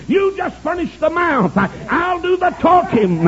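high, drawn-out proclamation; fervent preaching. Retold or imitated. God answering hesitant Moses, fervent preaching. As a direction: proclaim God's answer high, stretching the last line.

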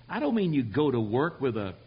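ordinary talk

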